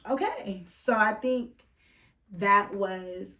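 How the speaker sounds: ordinary talk, nothing marked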